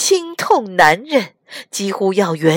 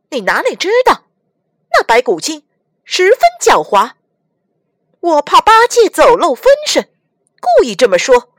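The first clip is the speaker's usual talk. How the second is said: Monkey King's secret; 8 semitones higher, 6 dB louder, faster than usual. Acted. Monkey explains his trick.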